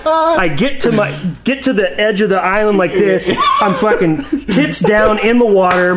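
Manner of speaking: laughing. Real, not acted. Laughing through the fall story.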